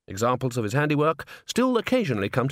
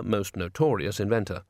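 usual speaking voice

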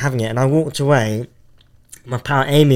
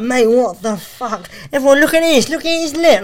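jeering coworker; 11 semitones higher than usual. Retold or imitated.

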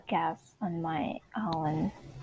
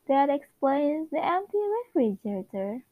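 sitcom delivery; 7 semitones higher, 3 dB louder, faster than usual. Acted. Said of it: "WandaVision script".

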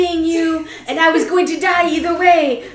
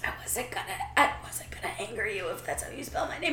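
timid mumble; 9 semitones lower, 14 dB quieter, faster than usual. Acted. Barry's timid excuse.

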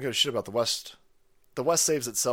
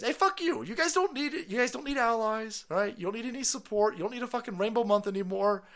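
much higher mocking voice; mock falsetto; 7 semitones higher than usual. Mocking the secretary, much higher. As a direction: sneer it up high.

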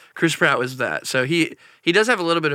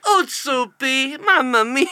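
Mario voice; 10 semitones higher, slower than usual. Pretend. Mock singing Mario voice.

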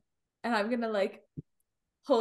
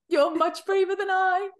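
"braver" raised and pressed; more emphatic; 6 semitones higher than usual. Acted. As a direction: push "braver" with feeling. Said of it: "you're much braver than I am".

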